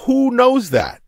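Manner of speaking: high-pitched disbelief; incredulous exclamation. Real, not acted. To co-host, who knows Cannavale is Cuban, incredulous. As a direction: throw up your hands in disbelief.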